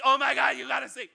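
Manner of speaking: loud, high gush; high, loud excited imitation; excited friends. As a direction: gush like a friend sharing a clip. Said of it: "people were sending them to me".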